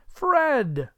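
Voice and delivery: high, loud, hearty hail; calling out. A narrator's reading. Scrooge calls to his nephew from the door, calling out. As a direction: call the name out high.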